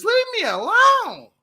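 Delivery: very high, drawn-out wail; stretched-out falsetto outburst; blazing voice. Acted. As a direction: put on a high, slow, loud cry. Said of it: "with my, with my blazing voice".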